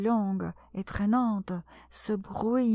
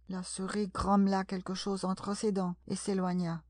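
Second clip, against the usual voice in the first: ordinary delivery, nothing marked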